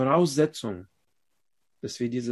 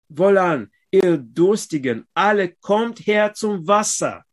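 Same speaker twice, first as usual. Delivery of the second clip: raised, loud proclaiming of the verses; preaching scripture; 6 semitones higher, 5 dB louder than usual. Acted. Preacher reads Isaiah's call to the congregation, loud and raised. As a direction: call the thirsty to the water like a prophet.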